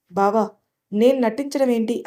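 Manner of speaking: ordinary delivery, nothing marked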